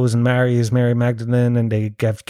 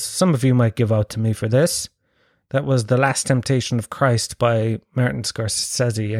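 ordinary talk, nothing marked

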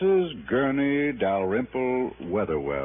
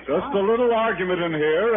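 raised, higher voice settling a crowd; calling out reassurance; 10 semitones higher than usual. Acted. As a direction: call out to the folks outside that all is fine. Calming onlookers after scuffle.

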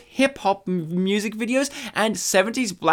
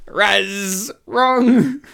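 game show buzzer call; 3 semitones higher, 4 dB louder than usual. Pretend. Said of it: "I think you meant bzzt".

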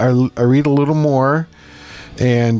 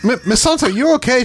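concerned tech; 11 semitones higher than usual. Retold or imitated. Tech asking patient, retold.

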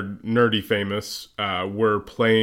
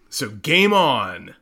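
slow intro tagline; 3 semitones higher, slower than usual. Acted. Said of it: "You're hoping that's gonna be our intro?".

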